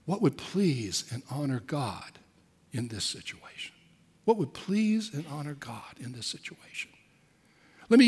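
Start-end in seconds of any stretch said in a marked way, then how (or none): 0.1-3.7 quiet reflective question. Shown as a demonstration. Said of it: "is simply to say". 4.3-6.9 hushed repeat. Shown as a demonstration. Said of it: "is simply to say".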